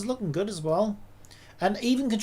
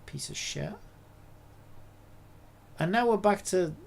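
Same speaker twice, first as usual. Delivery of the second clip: ordinary talk, nothing marked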